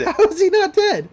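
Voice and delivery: high, loud echo of outrage; laugh, then yell it in disbelief; exasperated comic holler; incredulous shout. Real, not acted. Echoes partner's outraged question.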